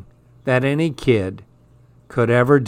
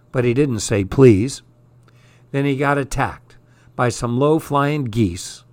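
ordinary delivery, nothing marked

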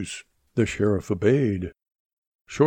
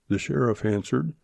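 plain reading voice

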